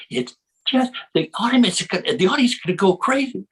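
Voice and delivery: high, excited ad executive voice; excited pitch. Acted. Ad woman pitching her idea to the table, hammed-up excitement. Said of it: "this lady gets up and she says".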